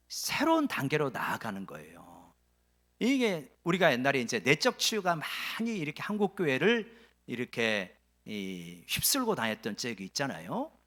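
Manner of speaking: usual speaking voice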